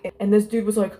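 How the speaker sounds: ordinary talk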